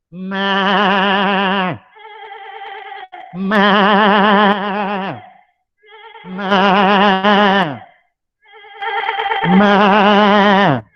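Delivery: drawn-out throaty ma bleats; long goat bleating ma sounds. Shown as a demonstration. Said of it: "make the sound of how does a goat".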